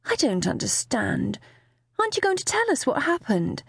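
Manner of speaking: bewildered mum, voice climbing high; utterly bewildered. A narrator's reading. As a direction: ask it lost and baffled. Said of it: "sounding utterly bewildered".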